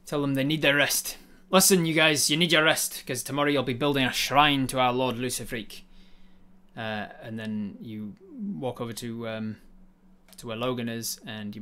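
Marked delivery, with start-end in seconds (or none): 1.5-5.8 louder bossy announcement; commanding address. Acted. Tyrant loudly ordering prisoners to rest.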